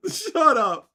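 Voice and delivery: loud, high "shut up", laugh fading; delighted disbelief. Real, not acted. Still reacting to the flat earth globe error, loud and high. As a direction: repeat it, still amazed.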